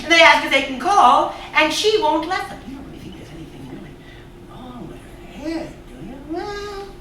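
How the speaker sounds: ordinary talk, nothing marked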